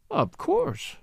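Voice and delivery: "Of course" pitched very high with heavy emphasis; exaggerated emphasis. A narrator's reading. Daughter assuring her father, "Of course" with exaggerated emphasis. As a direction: overstress "Of course", leaping up high. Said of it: "She spoke with exaggerated emphasis".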